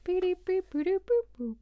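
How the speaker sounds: high, sing-song beeps and boops; playful beeping. Real, not acted. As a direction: make little high-pitched electronic noises. Playful noises on returning to chat.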